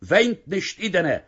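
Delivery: put-on loud, high shout at the old woman. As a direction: bark it out over the table. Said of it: "ארויסגעשריגן".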